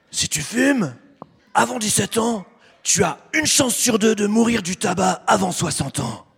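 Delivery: gruff imitated smoker's voice; smoker's rasp. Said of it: "Avec leur petite voix de fumeur".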